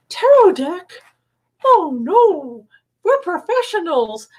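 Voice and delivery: high, far-off mock-offended squeal; mock horrified. Friend hears she read tarot on radio, mock horrified high squeal. Retelling reaction to tarot news.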